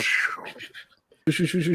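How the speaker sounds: rapid whooshing pew-pew shots; airy shooting noises; imitated gunfire. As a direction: mimic guns firing in quick bursts. Imitates gunfire in his retelling.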